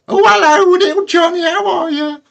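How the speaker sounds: very high, squeaky granny greeting; old granny voice. Pretend. Nana Scab greeting the birthday boy in a high granny voice. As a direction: sweetly greet little Johnny as a doting nan. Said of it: "I was gonna be all like".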